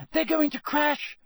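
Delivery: very high alarmed cry; Hermione shrieking. A narrator's reading. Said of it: "shrieked Hermione".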